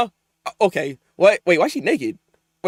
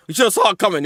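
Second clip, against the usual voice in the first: ordinary talk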